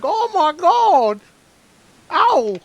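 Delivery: high, slow hammed-up screaming; mock screams of pain. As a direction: wail it out like a mauled victim. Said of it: "She's like".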